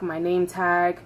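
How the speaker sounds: plain reading voice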